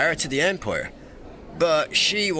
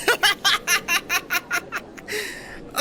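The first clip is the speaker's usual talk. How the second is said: very high, rattling laugh; long gleeful giggle; teasing laughter. Acted. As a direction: cackle at someone's stunned face. Laughs at archivist's face.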